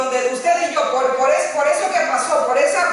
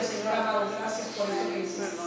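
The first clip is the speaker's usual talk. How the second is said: hushed grateful prayer; 5 semitones lower, 11 dB quieter than usual. Shown as a demonstration. Model prayer of thanks.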